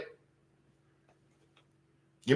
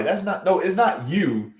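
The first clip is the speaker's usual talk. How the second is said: ordinary delivery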